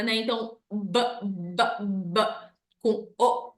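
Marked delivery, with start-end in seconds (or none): 0.7-2.2 "b" as a short, separate sound, repeated; isolated letter sound. Shown as a demonstration. Teacher demonstrating phonics to parents, isolated "b" sounds. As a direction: pop out each "b" on its own, with gaps. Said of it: "faz os sons de cada palavra".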